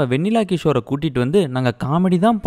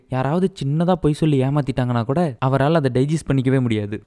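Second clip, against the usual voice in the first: normal talking voice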